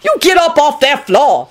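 high, angry acted order; wrathful shout. A narrator's reading. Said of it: "Lucretia shouted wrathfully".

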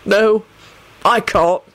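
mildly desolate, broken up by a pause; understated despair. A narrator's reading. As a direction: give in hopelessly. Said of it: "replied in a desolate voice".

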